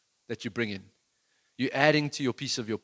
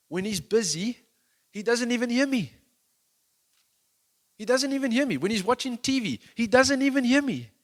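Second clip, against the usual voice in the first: higher, louder frustrated dad complaint; exasperated complaint; 11 semitones higher, 6 dB louder than usual. Retold or imitated. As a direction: vent like a fed-up father. Preacher voicing exasperated dad.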